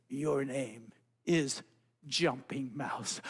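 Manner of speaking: very slow, low frog voice; solemn naming. Acted. Brother Frog gives the mouse his new name, very slow and low. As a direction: announce it like a ceremony.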